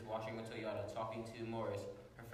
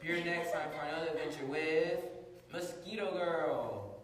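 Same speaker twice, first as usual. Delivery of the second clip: announcer sign-off; 6 semitones higher, 6 dB louder than usual. Acted. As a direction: boom the closing like a radio host.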